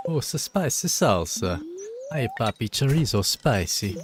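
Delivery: a playful mock Mexican accent on spicy salsa. Streamer hypes spicy salsa in a playful mock Mexican accent. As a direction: purr it out in a hammed-up Mexican accent. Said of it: "Why am I using that accent?".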